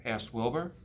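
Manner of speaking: usual speaking voice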